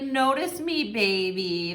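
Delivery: lightly pouty, whiny plea, pitch lifted; a hint of a sulky lover begging; mock pouting. Reader voices a pouting partner wanting attention, lightly whiny. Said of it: "I feel like they're pouting".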